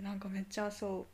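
ordinary talk, nothing marked

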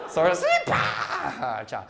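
"啪" very slow and drawn out, acting out a blast; mock explosion. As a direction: pop it like a firework going off. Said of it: "acting out the explosion".

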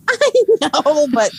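high-pitched laughing admission. Real, not acted. Admitting to co-host the birth remark was obvious, laughing. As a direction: squeak it out through a laugh. Laughing at her own slip.

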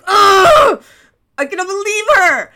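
shouting high and fast at mom about the teacher; angry teen outburst. Retold or imitated. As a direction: blow up like a furious teenager. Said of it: "very angry".

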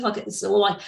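usual speaking voice

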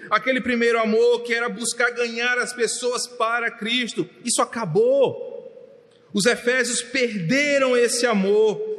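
high, forceful pulpit voice; pregação inflamada. Real, not acted. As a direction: preach it hard, each phrase a new push. Preaches at full force. (pregação exaltada, em voz alta e aguda)

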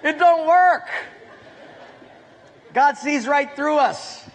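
laughing. Real, not acted. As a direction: laugh it out at the punchline. Laughing at own punchline.

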